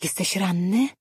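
plain reading voice

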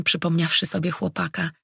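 plain reading voice

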